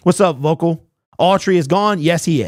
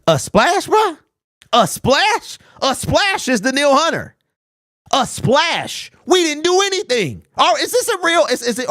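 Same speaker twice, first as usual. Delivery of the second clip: much higher, scoffing repeats of "splash"; "A splash?" in pitched-up disbelief; incredulous mockery; 9 semitones higher than usual. Real, not acted. Fan scoffing at the GM's "splash" claim, incredulous.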